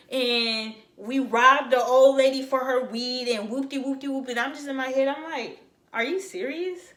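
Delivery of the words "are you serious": high, then louder disbelief; building incredulous repeat. Retold or imitated. Thinking about the girl's false story, building disbelief.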